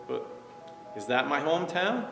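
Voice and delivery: usual speaking voice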